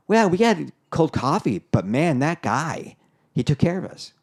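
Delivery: pleased customer raving about service; impressed customer. Retold or imitated. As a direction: gush like a delighted diner. Happy customer praising the waiter.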